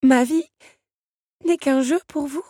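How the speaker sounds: high, loud, hurt disbelief; stunned insistence. A narrator's reading. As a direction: break it in two, aghast. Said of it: "Belle insista". (insistance abasourdie, voix aiguë)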